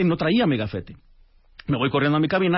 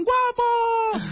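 very high, drawn-out mock cheer; retold group shout. Japanese students greeting him across the break, mock shouting. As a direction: imitate a crowd calling out a nickname, without full volume. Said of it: "me gritaban".

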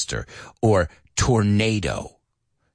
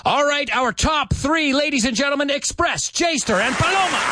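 announcer call. Acted. Announcing top three.

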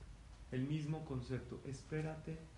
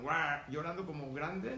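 asombro fingido; 3 dB louder than usual. Acted. Mock amazement at crying youth.

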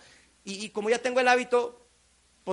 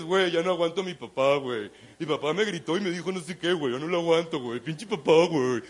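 lower, put-on complaining buddy voice; whining kid; 5 semitones lower than usual. Kid complaining to his friend about dad, whiny. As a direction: whine about dad like a teen.

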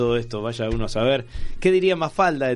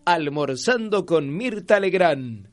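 TV promo announcer; 4 semitones higher, slower than usual. Retold or imitated. Quoting a TV channel promo.

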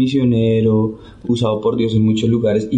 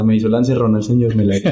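ordinary talk

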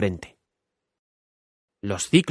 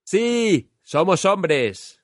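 slow, high, raised reply; louder, called up; 9 semitones higher, much slower than usual. Acted. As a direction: call it up to the giant. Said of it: "Habla un poco más alto". (más alto, gritando hacia arriba)

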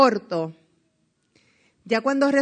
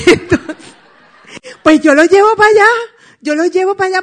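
much louder and higher, laughing; excited announcement; 9 semitones higher, 11 dB louder than usual. Retold or imitated. The leader loudly saying he's bringing the guests. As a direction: burst out with delighted energy.

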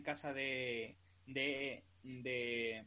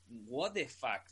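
slow drawn-out disbelief; stunned disbelief; 4 semitones lower, much slower than usual. Retold or imitated. Recalling watching the twist, slow stunned disbelief. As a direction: let it out slowly, stunned. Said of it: "cuando lo vi dije".

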